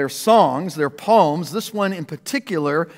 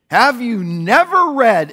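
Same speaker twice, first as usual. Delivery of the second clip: louder, slower challenge with never; forceful rebuke; 6 semitones higher, 6 dB louder than usual. Acted. Jesus answering indignant priests, loud slow rebuke. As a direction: confront them with the question.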